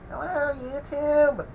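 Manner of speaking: high, slow, put-on YouTuber greeting; mock early-video voice. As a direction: ham up your old intro voice. Mocking his own early-video voice.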